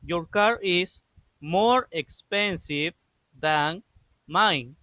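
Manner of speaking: drawn-out, deliberate reading of the finished sentence; slow it right down so students can copy it; slow model reading. Shown as a demonstration. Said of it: "Y queda de esta forma". (lectura muy lenta y pausada, como dictado)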